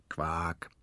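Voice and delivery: low, soft, drawn-out croak; frog croak. A narrator's reading. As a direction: drop in a frog's croak. Frog croak interrupts his speech. (leises, tiefes Quaken)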